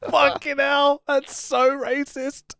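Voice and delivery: high, appalled exclamation, slowing at the end; aghast disbelief; shocked. Retold or imitated. Reacting to Heenan's joke as a fan, high appalled disbelief. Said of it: "You're just like".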